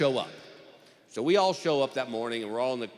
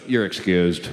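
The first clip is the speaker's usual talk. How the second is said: deep, loud final ruling; judge's dismissal; 8 semitones lower, 6 dB louder, much slower than usual. Acted. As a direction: dismiss the juror in a low, firm voice.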